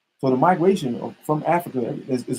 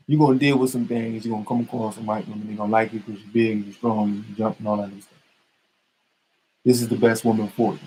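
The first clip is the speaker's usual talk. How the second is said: father's advice; 10 semitones lower, 5 dB quieter than usual. Retold or imitated. Father advising son on women.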